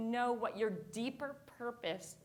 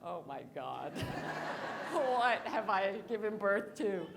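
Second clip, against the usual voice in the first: mom's shocked face; 4 semitones lower than usual. Retold or imitated. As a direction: drop the voice low and laugh in mock horror. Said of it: "the face she made, which is like".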